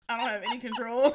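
laughing confession. Real, not acted. Admitting what happens when drunk, laughing. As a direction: admit it while cracking up.

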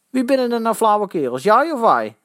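high, loud challenge; confrontational question. A narrator's reading. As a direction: demand an answer like a tough guy. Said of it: "vroeg de potige".

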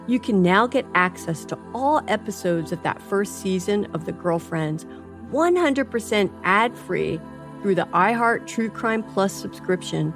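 ordinary talk

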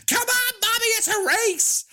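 high, gleeful squeal of a daughter; gleeful villain voice. Retold or imitated. Daughter taunting mother, high giddy squeal. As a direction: shriek it out like a giddy menace. Katie flinging mother, mock glee.

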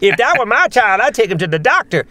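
high, scolding busybody voice; disapproving onlooker character. Acted. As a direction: put on a high-voiced, tutting bystander. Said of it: "and all the characters".

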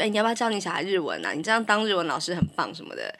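quick, quiet imitated question; fast quote. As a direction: rattle off the nagging question. Quoting people asking fast. (快速模仿别人问话)

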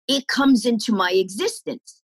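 ordinary delivery

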